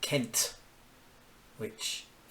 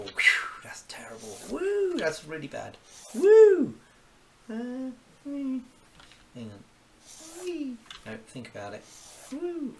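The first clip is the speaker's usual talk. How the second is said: playful whoops; 14 semitones higher, 11 dB louder than usual. Real, not acted. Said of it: "playful sounds while drawing".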